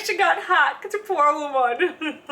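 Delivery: high laughing pity, slowed down; laughing mock pity. Retelling a hacked auntie, laughing high. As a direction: laugh through the words about the poor woman. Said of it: "I was like".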